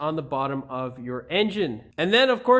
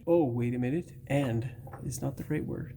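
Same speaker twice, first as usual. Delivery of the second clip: ordinary talk, nothing marked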